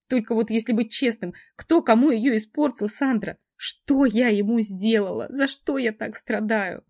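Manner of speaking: high, loud, breaking with tears; tearful outburst. A narrator's reading. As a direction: pour out the pain, sobbing. Heroine sobs her story to friend.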